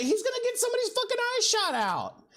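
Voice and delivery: very high, strained mock protest; exasperated retort. Teller defending the tackle to family, high exasperated protest. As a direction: argue back in acted, high-pitched disbelief.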